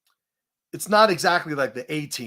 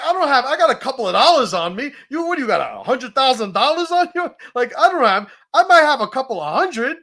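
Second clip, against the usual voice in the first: high loud mocking disbelief; 9 semitones higher, 8 dB louder than usual. Mock reply about his cash.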